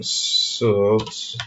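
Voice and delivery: "Suit" drawn out softly; quiet, hesitant attempt at "Suit"; unsure pronunciation. Real, not acted. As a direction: sound out "Suit" slowly and tentatively. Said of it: "I don't know how to pronounce".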